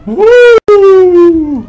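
long, high-pitched woo; drawn-out airborne woo; high whoop. Real, not acted. Floating in game.

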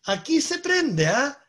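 imitating an old-school teacher showing a child. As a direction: talk a kid through it like a fussy teacher. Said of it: "el profe decía".

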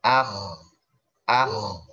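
"Ah" with a heavy back-of-tongue kh; thick kho. Shown as a demonstration. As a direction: raise the back of the tongue and scrape the kh in "Ah". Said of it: "pangkal lidahnya naikin".

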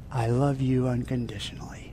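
low, hushed whisper after a long pause; intimate whisper. Acted. Jesus whispers to the communicant holding bread and wine, intimate whisper. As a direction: whisper it tenderly into one ear. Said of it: "He whispers into your ear".

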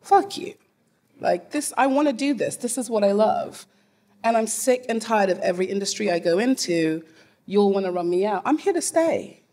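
a fired-up, defiant stand against the industry. Retold or imitated. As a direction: throw it back at them, fired up. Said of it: "I was just like".

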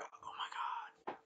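slow, barely voiced whisper; whispered amazement. She watches him go to attack, slow awed whisper. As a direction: whisper it as a retold stunned reaction. Retold shocked reaction to Alucard attacking.

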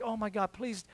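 soft, anxious plea, barely voiced; hushed panicked pleading; pleading under breath. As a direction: beg quietly so nobody hears. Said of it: "I'm like".

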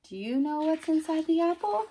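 high-pitched, rising curiosity; sing-song question to small children; playful coaxing question. Acted. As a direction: lift it high as a guessing game. Coaxing child listeners to guess.

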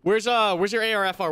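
high, loud frantic question; frantic shout. Acted. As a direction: yell it in desperation. Wounded teammate critical.

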